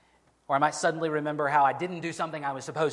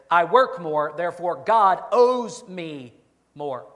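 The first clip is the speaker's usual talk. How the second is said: loud, slowed, emphatic claim on God; entitled demand; 3 semitones higher, 9 dB louder, slower than usual. Acted. Preacher voicing the worker who thinks God owes him, loud entitled demand. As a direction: press each word like a demand. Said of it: "the corollary of that is".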